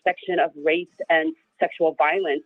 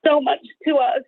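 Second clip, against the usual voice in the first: real tearful gratitude, pitched higher; getting emotional; 6 semitones higher than usual. Real, not acted. Guest telling fellow women what their support means, emotional. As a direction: push haltingly through welling tears, higher. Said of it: "I'm getting emotional".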